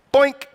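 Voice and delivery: squeaky high cartoon boink; playful click sound. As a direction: pop it out high and loud. Sound effect of clicking play.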